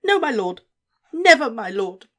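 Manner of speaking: high, loud eager pledge; fervent vow. A narrator's reading. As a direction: swear it eagerly and loudly. Wormtail swears loyalty.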